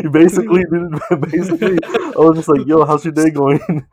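laughing through the punchline. Real, not acted. Friend retelling strip club story, laughing hard.

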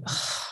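reluctant exasperated ugh, almost breathed; annoyed groan. Retold or imitated. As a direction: groan like a grudging teenager. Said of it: "I was like".

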